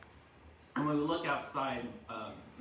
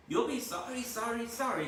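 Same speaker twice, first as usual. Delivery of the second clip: much higher honking warning; goose voice; 11 semitones higher, 4 dB louder than usual. A narrator's reading. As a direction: taunt the pig. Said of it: "called the goose".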